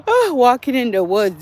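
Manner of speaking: high, loud startled exclamation. Real, not acted. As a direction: jump at a sound in the woods. Startled by sounds in woods.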